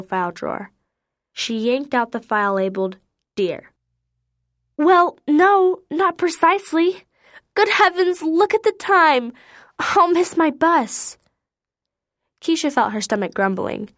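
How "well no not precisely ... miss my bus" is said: put-on high, fluttery, fussing old lady; a flustered lady clucking over the poor animal; fussy lady caller. A narrator's reading. Caller to Keisha about the deer, high fussy lady voice.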